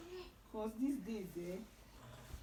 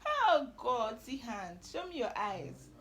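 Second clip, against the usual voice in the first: ordinary talk, nothing marked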